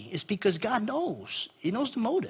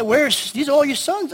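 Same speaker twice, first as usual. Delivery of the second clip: pitched-up asking who's left; high surprised question; 9 semitones higher, 8 dB louder than usual. Retold or imitated. Samuel asking Jesse.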